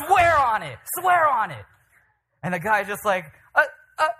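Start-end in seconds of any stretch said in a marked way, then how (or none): 0.1-1.7 loud, high challenge falling at the end; full-voiced acted shout, pitched way up; yelling back. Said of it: "our beloved brother yells back". 3.5-4.1 high, loud flustered stammer; acted speechless stutter; caught-out stammer. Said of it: "just kinda defeated and embarrassed".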